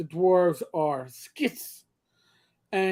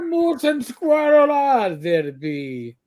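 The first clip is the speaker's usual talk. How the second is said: slow, higher cartoon Russian; Boris Bedinov; 6 semitones higher, 4 dB louder, slower than usual. Spy hunting moose and squirrel, slow mock Russian accent. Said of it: "sounds like Boris Bedinov".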